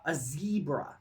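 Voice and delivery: "zebra" said as ZEE-bruh; American "zebra", first syllable like "zee"; American pronunciation. Shown as a demonstration. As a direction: say "zebra" with a long ee, American style. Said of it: "in America, they call".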